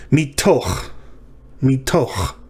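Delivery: ordinary talk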